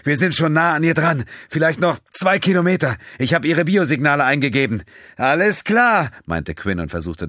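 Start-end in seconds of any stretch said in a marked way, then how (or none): 0.0-4.8 higher, louder shouting over the engines; Terrell calling out. Acted. Terrell updates the pilot during the storm, calling out loudly. 5.2-6.1 higher, louder quick reply. Acted. The pilot answers Terrell mid-storm, loudly.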